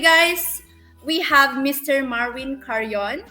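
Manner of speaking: usual speaking voice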